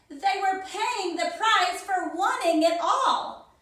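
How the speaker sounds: plain reading voice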